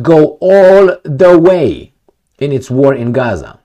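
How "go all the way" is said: louder, slower, "all" stressed; loud drawn-out vow; emphatic slow quote. Retold or imitated. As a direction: drag out "go all the way" loudly. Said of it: "Netanyahu said".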